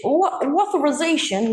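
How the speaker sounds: Boston accent. Shown as a demonstration. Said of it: "that's my Boston accent".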